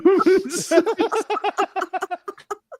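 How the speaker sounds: shrieking laughter; high laugh. Real, not acted. Laughing hard in the marketing meeting. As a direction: laugh helplessly.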